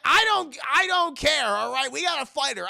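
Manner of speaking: loud, high mock-angry outburst; impatient retort. Acted quarrel over fighting a girl.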